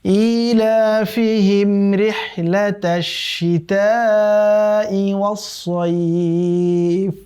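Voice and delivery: slow, drawn-out melodic tilawat; Quran recitation. Shown as a demonstration. Teacher recites the verse for students in slow melodic chant. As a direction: chant the verse slowly with long held vowels. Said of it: "আবারও পড়ছি".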